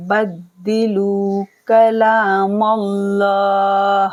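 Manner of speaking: slow chant stretching the long vowels; Quran recitation. Shown as a demonstration. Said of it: "लाम को मोटा पढ़ेंगे".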